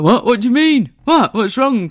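loud, high puzzled questions; baffled husband. Retold or imitated. Husband not seeing the toothpaste problem, loudly baffled. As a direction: fire back bewildered, indignant questions. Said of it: "he was like".

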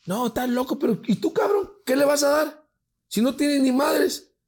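high, loud mock scolding; berating sister. Sister berates brother who has no money, exasperated.

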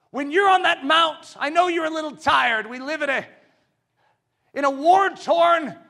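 high, loud shout from the pulpit; preaching shout. Acted. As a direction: declare it with full force. Preacher at full force.

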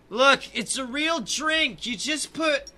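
high, loud, drawn-out protest; exasperated high-pitched complaint; loud drunken arguing. Acted. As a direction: argue it loudly, stretching the words.

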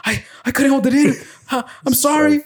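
very high, mock-upset kid voice; pleading little brother. Little brother apologising to his yelling mom, high pleading mimicry.

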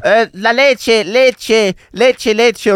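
high, slow mock call out the window in Spanish. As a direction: stretch out each leche as a plaintive call. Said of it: "raus, rausgerufen". (gespielter Ruf aus dem Fenster, hoch und langsam)

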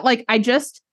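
normal talking voice

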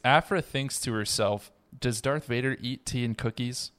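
ordinary talk, nothing marked

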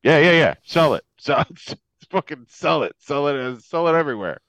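loud, repeated insistence, hammed up; imitated Republicans. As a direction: impatiently urge it on, mock politician. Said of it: "even Republicans now are like".

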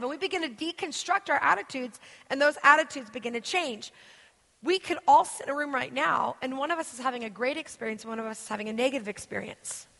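normal talking voice

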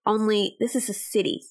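story narration. Acted. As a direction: read it like a travel memoir. Dreamy narrated story intro.

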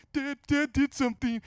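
high, loud blubbering stammer; mock crying. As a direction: sob out a tattletale complaint, hammed-up. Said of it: "she sees me crying".

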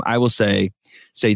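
normal talking voice

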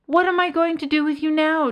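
high, loud exasperated moan. A narrator's reading. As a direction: moan it out in despair. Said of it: "Death moaned".

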